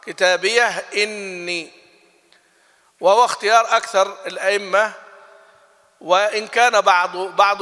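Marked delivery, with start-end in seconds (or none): 0.0-1.7 "كتابية إني" slow, a clean stop on the ha before إني; slow "كتابية إني" with the ha kept silent and unlinked; recited without naql. Shown as a demonstration. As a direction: hold the sakin ha of "كتابية" and restart on إني. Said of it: "بالإسكان يعني بدون نقل".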